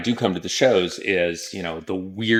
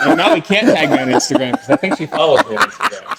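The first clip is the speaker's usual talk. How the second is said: laughing; 4 semitones higher than usual. Real, not acted. Laughing through embarrassed aside.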